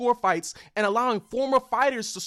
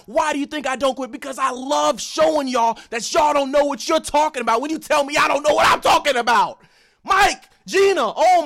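real angry yelling, louder and higher; losing control while ranting at the haters; unhinged rant; 4 semitones higher, 5 dB louder than usual. Real, not acted. Ranting at online critics, real angry yelling. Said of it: "I know I'm out of control right now. I'm unhinged. I'm angry".

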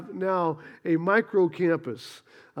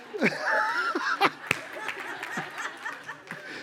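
high burst of real laughter; 7 dB quieter than usual. Real, not acted. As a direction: laugh at your own punchline. Laughs at own joke.

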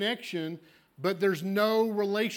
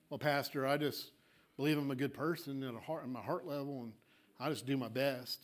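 low, soft mumbled excuse to the pastor; softly voiced congregant making excuses; meek churchgoer; 5 semitones lower, 7 dB quieter than usual. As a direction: play a churchgoer quietly excusing himself.

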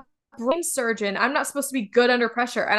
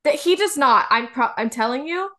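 ordinary talk, nothing marked

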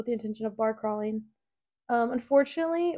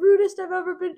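mock outrage; 7 semitones higher, 7 dB louder than usual. Mock outrage over closed restaurant.

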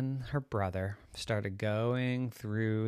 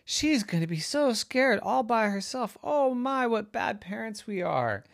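fretting mommy; 11 semitones higher than usual. Acted. Mom frets over lost child.

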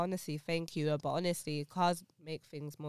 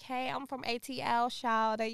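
put-on higher-pitched Atlanta Southern accent; 5 semitones higher than usual. Co-host impersonating someone from Atlanta, higher Southern Atlanta accent. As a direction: imitate an Atlanta local, pitched up. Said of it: "And then Atlanta's like".

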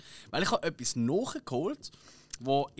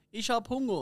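high-pitched robot voice reading out words; a gorilla's translator robot, pitched up; 8 semitones higher than usual. Retold or imitated. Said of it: "dann übersetzt eine Roboterstimme". (hohe Roboterstimme)

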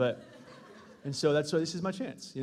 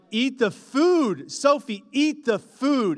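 higher, louder, slow stern order; stern raised voice; 5 semitones higher, 6 dB louder, much slower than usual. Retold or imitated. Dad orders Sophie to eat, higher, louder and slow. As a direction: hold back a yell but still raise it. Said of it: "trying to not raise our voices".

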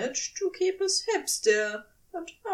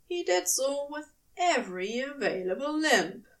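usual speaking voice

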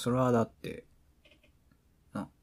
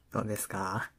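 ordinary talk, nothing marked

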